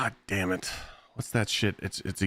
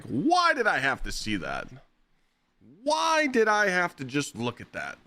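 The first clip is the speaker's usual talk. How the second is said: plaintive lament, pitched up then dropping; retold dismay; 6 semitones higher than usual. Rider telling of a fatal crash he witnessed, in retold dismay.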